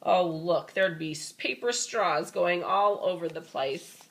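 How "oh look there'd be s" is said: ordinary delivery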